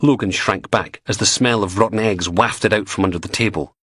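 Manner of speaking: ordinary delivery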